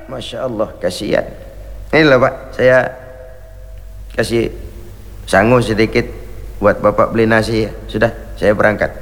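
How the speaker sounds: Umar's low kind voice. Retold or imitated. Umar gives the hungry man money, low and gentle. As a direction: speak low and kindly, like a humble ruler.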